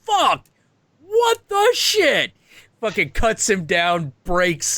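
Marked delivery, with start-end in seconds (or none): 0.0-2.3 high, slow shocked cry of disbelief; shocked outburst. Retold or imitated. The husband bursting in, high shocked swearing. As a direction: yelp it out high and stretched.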